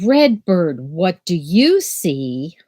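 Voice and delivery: normal talking voice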